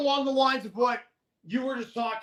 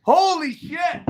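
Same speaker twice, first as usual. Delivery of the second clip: retold amazed exclaim; 3 semitones lower, 10 dB louder, much slower than usual. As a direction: blurt it like the news just landed. Learns he's called up.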